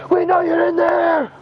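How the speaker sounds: high, loud cop yell; police shout. Pretend. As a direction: shout it through the door. Playing police at the door.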